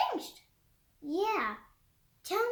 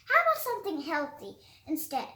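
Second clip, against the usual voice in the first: ordinary delivery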